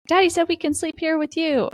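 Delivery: put-on high, childlike announcement; little girl. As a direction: pipe up like a kid running in. Acting little girl running in.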